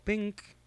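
high-pitched little "bink"; imitated bink noise. Gamer mimicking his opponent quitting, high bink. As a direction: pop out a tiny high blip.

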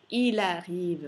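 "il arrive" slowly linked, the L running into the A; clear liaison. Shown as a demonstration. Teacher shows learners a liaison, slowly linked. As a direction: join "il arrive" as i-la-rive. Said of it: "facciamo una bella liaison".

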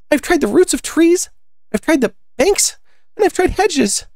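very high, fretful bird character; high-pitched storybook exasperation; exasperated pigeon. Acted. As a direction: complain in a piercingly high pigeon voice.

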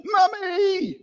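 put-on high, wailing child's call; frightened toddler. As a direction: cry out for mum like a scared toddler. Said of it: "they turn around to mum or dad and they go".

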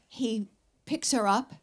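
usual speaking voice

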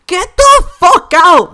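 high, slow, full-volume shout; enraged shout. Real, not acted. Player raging at a spammy opponent, enraged shout. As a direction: shout each word in real fury.

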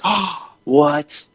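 high sudden gasp of a question; mock startled exclamation. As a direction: caught off guard by the buck. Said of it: "I'm like, gasps".

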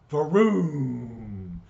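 loud, drawn-out vroom; explosion noise. Acted. Narrator sounds out the robot blowing up, imitated explosion. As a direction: voice the blast as one long falling whoosh.

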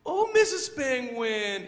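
high, loud little-bird voice; slow, pleading baby bird; Choco. Acted. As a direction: cry out high and drawn-out as a lonely chick. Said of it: "How about you be the voice of Choco?".

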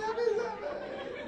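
very high, loud, slow sing-song "Happy Sabbath!"; fake happy greeting. Preacher mimics the church greeting again, laughing, over the top. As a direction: overplay the cheery church hello. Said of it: "we put on that face".

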